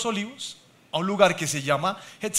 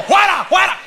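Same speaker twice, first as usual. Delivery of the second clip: loud, high mock rebuke; commanding rebuke; 9 semitones higher, 6 dB louder than usual. Husband rebuking in prayer, loud mock command. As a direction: cast it out with force. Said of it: "voy orando en lenguas".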